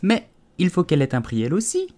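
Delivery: high-pitched little mouse voice; objecting mouse. A narrator's reading. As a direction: pipe up with a high, questioning objection.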